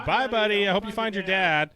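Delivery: high, bright singsong farewell; cheery narwhal goodbye. Retold or imitated. Quoting the movie narwhal to a co-host, high and cheery. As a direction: wave him off like a sweet cartoon narwhal. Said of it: "when Narwhal says".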